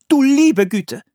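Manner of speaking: high, loud woman's cry; startled exclamation. A narrator's reading. Woman on finding the captured boy, exclaiming loudly. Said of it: "rief eine Frau".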